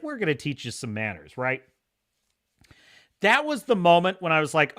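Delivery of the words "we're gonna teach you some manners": lower, softer cold threat; a hint of quiet menace; menacing samurai. Samurai threatening the pinned Englishman, quiet mock menace. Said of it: "the samurai's like".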